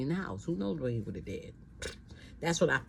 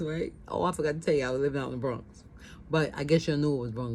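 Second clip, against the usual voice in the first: ordinary talk, nothing marked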